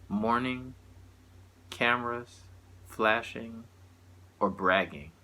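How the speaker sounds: measured spoken-word delivery with long pauses between lines; poetry recital. Acted. Poet performing a satirical poem to listeners, measured recital. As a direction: recite it line by line, letting each phrase land. Reads own poem aloud.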